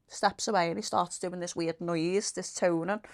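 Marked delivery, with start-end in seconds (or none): none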